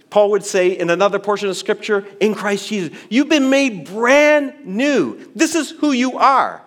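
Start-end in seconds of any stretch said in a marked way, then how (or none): 3.1-6.6 put-on broad singsong kids theatre. Preacher proclaiming new identity.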